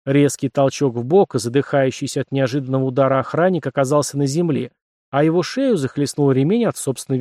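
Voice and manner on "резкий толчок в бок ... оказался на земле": ordinary delivery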